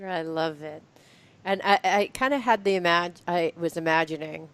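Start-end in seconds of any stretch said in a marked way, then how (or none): none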